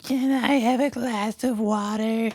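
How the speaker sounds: put-on high, sweet little girl voice; polite little girl. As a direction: ask like a polite small child. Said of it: "she asked politely".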